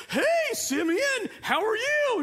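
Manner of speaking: very high, drawn-out cartoon greeting; squeaky mock cartoon host; cartoon character. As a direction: imitate a chirpy little cartoon. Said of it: "The cartoon character comes up like".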